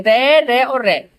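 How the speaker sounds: each रे said slowly and separately; slow listing. Shown as a demonstration. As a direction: count the three रे out slowly. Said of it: "ये तीनों रे हैं". (धीरे-धीरे गिनाना)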